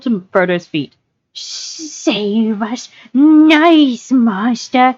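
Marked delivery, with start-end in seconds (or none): 1.3-4.9 high, whining creature plea. A narrator's reading. Creature crawling to his master's feet, high whine. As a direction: whine upward to your master. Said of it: "he whined".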